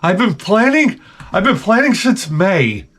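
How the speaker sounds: high, put-on coworker voice; mimicked young coworker. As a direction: imitate her insisting, pitched well up. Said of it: "She's like".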